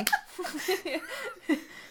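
laughing. Real, not acted. Laughing at friend's accent.

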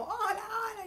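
hola hola muy agudo y cantado; saludo agudo imitado. Retold or imitated. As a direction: imita a la vendedora con voz finita. (saludo de vendedora con voz muy aguda)

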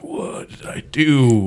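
slow, drawn-out lament; mock despair. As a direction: stretch the question out in mock dismay. Retells dismay at exes marrying.

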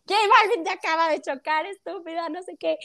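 imitated high-pitched exasperation; retold excited scolding. Imitating her brother revealing the celebrity, retold exasperation. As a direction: break the news incredulously, high. Voices brother breaking the news.

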